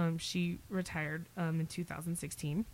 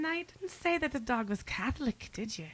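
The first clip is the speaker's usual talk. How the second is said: high Irish brogue for the joke; Irish accent telling a joke; 6 semitones higher than usual. Acted. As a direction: tell it as an Irish priest would. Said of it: "my favorite Irish joke".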